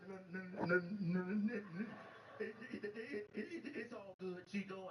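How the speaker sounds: hammed-up stutter on it, it, it; stuttering waiter. Waiter tells the comic it's all good, stuttering. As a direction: imitate the waiter tripping on his words. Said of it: "he was like".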